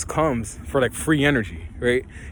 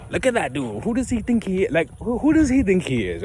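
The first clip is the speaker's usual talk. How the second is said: mock judgy onlooker; 6 semitones higher than usual. People judging him, mocking quote.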